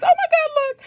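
very high, loud delighted shriek; squealing with real delight; excited shriek. Real, not acted. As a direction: burst out with joy at the box. Unboxing the brownie box.

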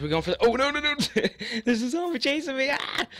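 high panicked cry; panicked yelp. Real, not acted. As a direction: squeal in sudden alarm. Zombie suddenly chasing him.

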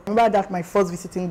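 usual speaking voice

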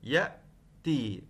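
يأتي drawn out slowly with the hamza clear; slow spelled-out word. Shown as a demonstration. Teacher answering her own question, slowly sounding out the word. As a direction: stretch يأتي so the hamza stands out. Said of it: "الهمزة في كلمة يأتي".

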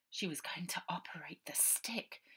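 soft whispered aside; awed whisper. A narrator's reading. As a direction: whisper it in disbelief. Narration whispered disbelief.

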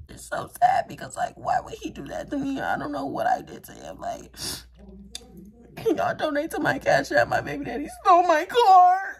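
hammed-up fake sob, voice climbing high; fake crying. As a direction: whine a fake sob story for sympathy. Fake sob story for donations.

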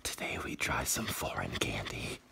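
soft whispered intro; whispering. Acted. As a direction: whisper it like a secret.

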